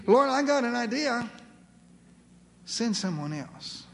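high, animated, pleading excuses to God; incredulous protest. Acted. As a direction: protest as if God must be kidding. Acted protest of reluctant servant.

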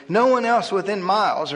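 plain reading voice

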